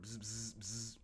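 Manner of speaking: soft, short buzzing noises; imitated phone buzz.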